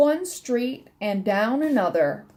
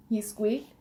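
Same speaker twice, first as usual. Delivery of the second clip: plain reading voice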